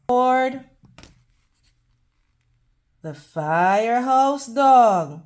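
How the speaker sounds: ordinary delivery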